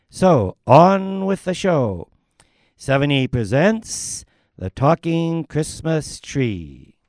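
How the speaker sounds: loud, slow stage announcement; grand opening-of-the-show announcement; announcer. Acted. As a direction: call it out slowly to the whole hall. MC announcing the play.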